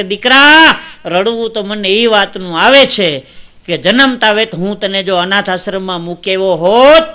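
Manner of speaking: loud, anguished cry of a father to his son; acted tearful plea. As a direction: declaim the hurt, calling out loudly. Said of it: "બાપ રડતો રડતો એટલું કહેતો હતો". (પિતાની ભાવુક, મોટેથી કરેલી વ્યથા)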